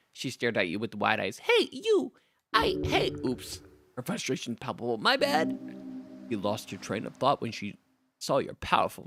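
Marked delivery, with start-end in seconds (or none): 1.4-3.6 goblin voice. Acted. Goblin bard startled by player. 5.0-5.6 goblin voice. Acted.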